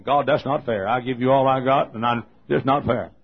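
shouted, indignant protest; hammed-up outrage, loud; loud complaint to God. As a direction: rail at God at full volume. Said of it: "I was in my office saying".

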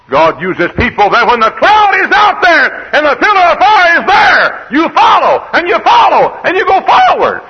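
very high, loud rallying cry; full-force preaching shout. Real, not acted. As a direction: hammer each phrase out from the pulpit.

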